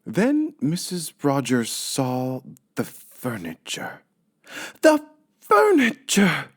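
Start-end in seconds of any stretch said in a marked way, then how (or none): 4.8-6.5 high, loud, slow wail; cry of dismay. Acted. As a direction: shriek it in horror. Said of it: "she cried".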